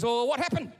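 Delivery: a hint of an anxious father; prompting father. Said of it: "And Mike goes".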